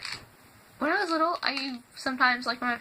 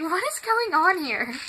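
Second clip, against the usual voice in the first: higher, drawn-out baffled question; retold confusion; 6 semitones higher, 3 dB louder, slower than usual. Watching a weird reality show with Mom, confused. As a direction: stretch it out in mock bewilderment. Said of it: "I was, like, so confused".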